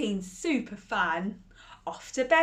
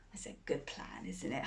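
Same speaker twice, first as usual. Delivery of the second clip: whisper; 9 semitones lower, 13 dB quieter, faster than usual. Real, not acted. Aside to children.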